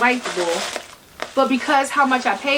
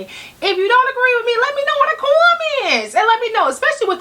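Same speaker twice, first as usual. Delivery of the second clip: excited call-out; 11 semitones higher, faster than usual. Real, not acted. Calling out to viewers.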